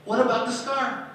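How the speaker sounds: put-on high, loud kids cutting in; impatient kids. Pupils interrupting the teacher's story, high and loud. Children pleading at bell.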